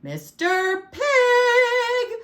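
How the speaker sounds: loud call, climbing very high and long. A narrator's reading. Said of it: "I called".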